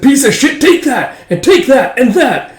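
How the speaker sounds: high, loud shouts with each blow; enraged high-pitched outburst; furious yelling. Acted. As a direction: yell it while landing kicks. Soldier beating up a corpse.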